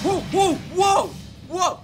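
high, loud panicked whoas; startled yells. Real, not acted. Gamer startled by a monster, yelling high and loud. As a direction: jump out of your skin and yell.

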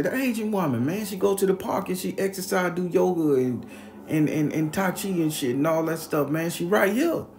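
high, loud explaining to a crowd; acted insistence. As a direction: plead your case to the whole street, re-enacted.

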